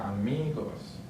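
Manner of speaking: "amigos" stressed on mi; stress on second-to-last syllable. Shown as a demonstration. Teacher shows the rule for words ending in S, "amigos" stressed on mi. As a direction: lean on the middle of "amigos": a-MI-gos. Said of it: "stress syllable is on the second to last".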